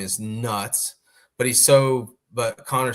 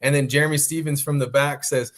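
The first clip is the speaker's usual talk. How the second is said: ordinary talk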